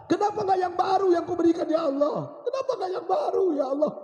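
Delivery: high, loud tearful wail; impassioned acted lament of a dying man; crying with regret. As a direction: cry out to God in anguish. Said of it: "Suamiku menangis".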